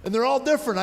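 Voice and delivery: ordinary talk, nothing marked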